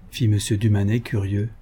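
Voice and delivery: ordinary delivery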